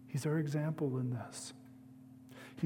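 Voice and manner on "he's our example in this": ordinary delivery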